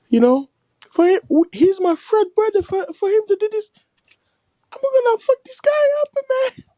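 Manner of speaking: very high mock-weeping threat; hammed-up tearful squeal of outrage; crying man. Retelling a man betrayed by his friend, mock crying.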